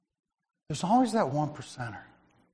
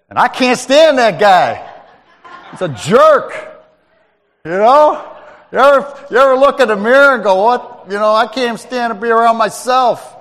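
loud, rising preacher's cry with a laugh; church, I can't stand that guy, shouted from the pulpit; fiery preaching; 7 semitones higher, 11 dB louder than usual. Acted. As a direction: preach it out to the church full force. Preaching at full force.

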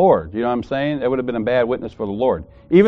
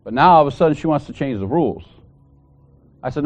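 ordinary talk, nothing marked

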